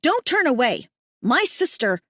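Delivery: high, loud demand; woman yells after the leader, loud; angry yell. Acted. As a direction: call him back, furious. Woman keeps yelling at Hayden.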